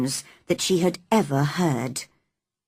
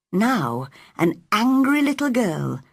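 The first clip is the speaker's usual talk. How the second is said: ordinary delivery, nothing marked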